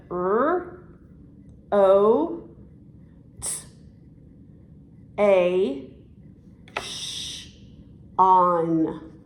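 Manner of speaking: each sound alone with long gaps, "r", "o", "t", "a", "sh", "on". Shown as a demonstration. Teacher breaking a word down for a child, sound by sound. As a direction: isolate every sound of "rotation", slowly and firmly. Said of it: "three talking vowels in that word".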